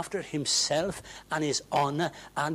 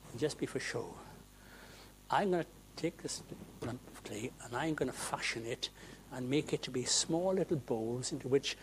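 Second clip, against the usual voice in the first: quiet potter; 11 dB quieter than usual. Pretend. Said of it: "and says".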